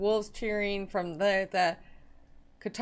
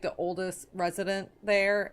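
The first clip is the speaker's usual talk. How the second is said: normal talking voice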